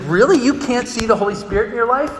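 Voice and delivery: high, loud-opening disbelieving question; incredulous. Retold or imitated. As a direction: act out a friend's astonished challenge. Retold friend's disbelieving challenge.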